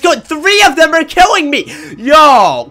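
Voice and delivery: high, loud gamer yelling; rising, exasperated shout; alarmed protest. Real, not acted. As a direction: cry out as you're being ganged up on. Attacked by several players.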